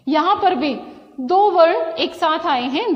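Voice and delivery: ordinary talk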